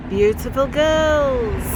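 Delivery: slow, high British rocker impression; loud, stretched mock English croon. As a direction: draw it out like a swooning rock star. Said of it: "[name] just looks up at him and he goes".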